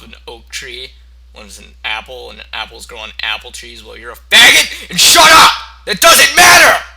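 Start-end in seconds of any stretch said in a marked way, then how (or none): 3.8-4.9 laughing insult. Real, not acted. Mock rant at imagined listener. 4.9-6.8 high, loud mock-furious shout; mock angry shout. Snapping at the listener who asked, loud fake fury.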